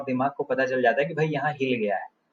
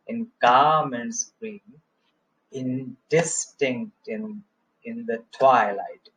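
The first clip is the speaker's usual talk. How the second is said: slow, rhythmic scanning of garments green and twilight; scansion; much slower than usual. Shown as a demonstration. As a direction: mark the beat slowly, garments and twilight stressed. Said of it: "यहाँ green पे थोड़ा सा वो change हुआ है". (garments और twilight पर ज़ोर, धीमी लय)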